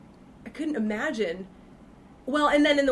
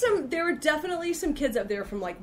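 normal talking voice

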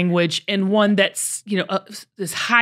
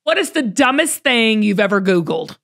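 normal talking voice

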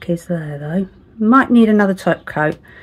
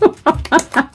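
giggle; 9 semitones higher, 4 dB louder than usual. Real, not acted. Laughs at own voice.

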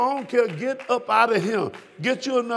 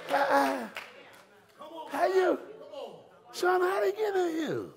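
the king questioning; 5 semitones higher, 3 dB quieter than usual. Acted. Acting the king questioning a wedding guest.

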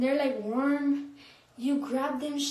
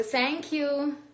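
ordinary delivery

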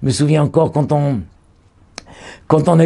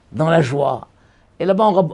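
usual speaking voice

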